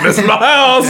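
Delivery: high laughing quote; imitating a proud trailer dweller; mock resident. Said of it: "people are walking out the door like".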